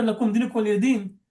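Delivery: "din" ending on a bare n; pause with sakin. Shown as a demonstration. Teacher repeating the paused ayat, "din" closed with sakin. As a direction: drop the final vowel and stop on "din". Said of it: "we always pause with sakin".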